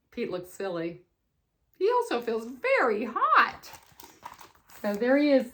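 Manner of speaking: ordinary delivery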